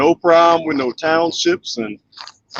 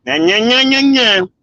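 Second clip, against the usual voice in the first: mocking nya nya; 8 semitones higher, 4 dB louder than usual. Real, not acted. Teasing his chat with a louder, higher playground taunt. Teasing viewers after a joke.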